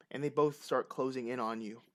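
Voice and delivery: ordinary talk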